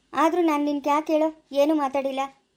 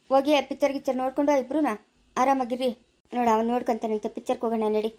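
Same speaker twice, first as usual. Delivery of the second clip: ordinary delivery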